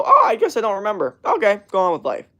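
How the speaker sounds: high, loud, breezy imitation; mock carefree. Imitating people untroubled by their forgetting, mock carefree. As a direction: imitate someone cheerfully shrugging it off.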